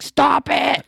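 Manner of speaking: high, loud mock yell of a mad little girl; imitating angry child. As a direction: shriek it like a cross kid. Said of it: "she'll get so mad. She'll be like".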